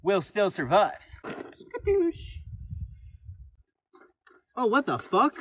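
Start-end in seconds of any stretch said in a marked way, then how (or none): 1.2-2.2 high, soft quoted catchphrase; Skadoosh catchphrase. Retold or imitated. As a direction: toss off the movie line. Movie catchphrase voice.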